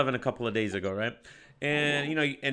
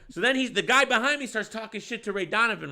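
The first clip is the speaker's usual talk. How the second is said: ordinary talk, nothing marked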